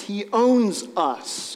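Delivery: every word slow and weighted; preacher landing the truth very slow; slow emphasis. Acted. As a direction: land each word slowly, like a verdict. Preacher stressing key point.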